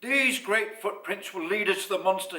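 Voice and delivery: loud lion taking charge; Ralph the lion. A narrator's reading. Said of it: "he said".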